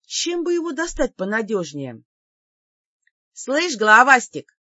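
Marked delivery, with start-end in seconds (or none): 3.3-4.6 brash call, loud and mocking; cocky shout. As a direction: yell it out as a cheeky taunt. Heroine taunts the demon.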